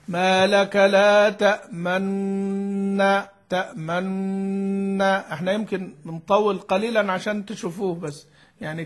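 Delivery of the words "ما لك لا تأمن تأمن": slow تأمن with a held doubled nun and lips rounding; ishmam demo. Shown as a demonstration. Teacher repeats تأمن slowly so students see the lip rounding. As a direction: draw out تأمن, holding the nun while the lips point to a damma. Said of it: "أنت الإشمام بتشير فقط".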